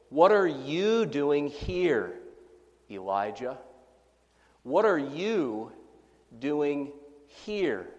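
slow, leaning hard on "are" in "What are you doing here"; stress on are. Shown as a demonstration. Preacher demonstrates inflecting God's question, slow with "are" stressed. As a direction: draw it out slowly and press "are" each time. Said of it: "inflect this question in this way".

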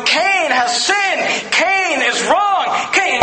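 blood accusing Cain. Acted. Blood of Abel voiced accusing.

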